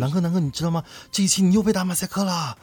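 imitating his sister. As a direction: play the excited younger sister breaking news. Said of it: "我家妹子都会说".

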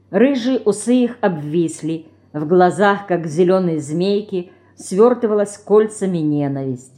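plain reading voice

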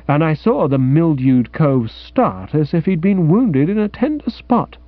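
plain reading voice

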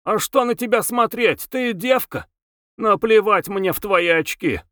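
high, whiny nasal voice; high nasal jeering; nasal squeal. A narrator's reading. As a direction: squeal it through the nose, scornfully. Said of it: "Гнусавый голос взвизгнул".

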